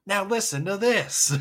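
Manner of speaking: mock-dramatic announcement; quoted line. Co-host throws out a quote, in a loud put-on voice.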